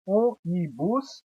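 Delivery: ônibus said slowly syllable by syllable; slow syllable demo. Shown as a demonstration. Teacher counting syllables of ônibus aloud, slowly. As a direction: stretch ônibus into its three beats. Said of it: "Três sílabas, trissílabas".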